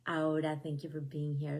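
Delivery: ordinary talk, nothing marked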